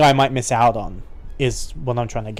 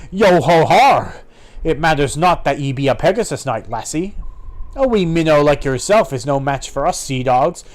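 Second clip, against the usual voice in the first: swagger like a sea dog before a fight; mocking pirate threat; menacing pirate.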